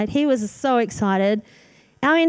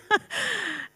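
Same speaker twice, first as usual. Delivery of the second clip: high airy laugh; 10 semitones higher, 7 dB quieter than usual. Real, not acted. Laughs at her own fear.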